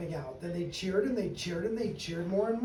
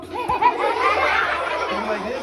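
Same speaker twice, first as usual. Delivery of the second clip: yawn demo; 5 semitones higher, 9 dB louder than usual. Shown as a demonstration. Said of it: "go like this".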